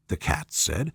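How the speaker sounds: plain reading voice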